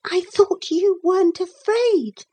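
put-on high-pitched little girl's aside; small, high girl's voice; whispering girl. A narrator's reading. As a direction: speak very high, as a child confiding. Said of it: "whispered Lucy".

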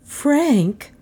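high, stunned woman's voice; high-pitched mild dismay; swallowed shock. A narrator's reading. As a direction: say the name as if swallowing hard. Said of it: "swallowing with a little croaking sound".

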